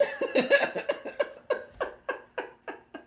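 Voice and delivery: high, loud real laugh at herself; embarrassed laugh. Real, not acted. Told she says name wrong.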